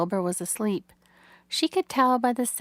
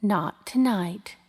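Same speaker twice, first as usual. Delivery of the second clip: usual speaking voice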